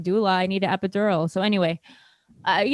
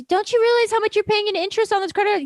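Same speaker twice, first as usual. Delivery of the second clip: high, mocking nagging mother; mom's voice; 14 semitones higher, 4 dB louder than usual. Daughter mocking mom's lecture about interest, high and shrill. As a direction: squeak it like a scolding mom. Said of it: "She says".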